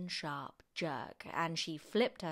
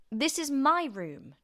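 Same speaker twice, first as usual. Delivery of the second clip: high, loud, slow protest; indignant boy; 8 semitones higher, 9 dB louder, slower than usual. A narrator's reading. George protests as grandma invades his room, indignant boy. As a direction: object loudly in a boy's voice.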